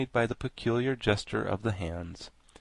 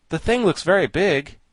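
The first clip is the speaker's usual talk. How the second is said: mildly anxious, higher and louder; 7 semitones higher, 7 dB louder than usual. A narrator's reading. Tip eyes the big Thing, mildly anxious. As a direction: voice lifted with a touch of worry. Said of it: "he said anxiously".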